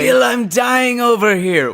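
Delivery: high, loud, drawn-out complaint; mock pirate protest. Scurvy pirate complaining to Bill, mock-anguished high yell. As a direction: cry out to a shipmate in mock agony.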